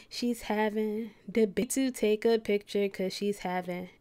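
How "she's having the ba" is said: ordinary talk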